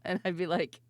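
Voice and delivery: ordinary talk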